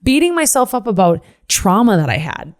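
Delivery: ordinary talk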